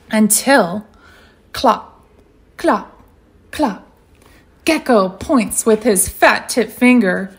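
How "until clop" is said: usual speaking voice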